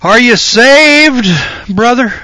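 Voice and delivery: high, loud, slow preachy question; mock preacher on the bank. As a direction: call down to him like a pious preacher. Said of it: "rush up to the bank and say".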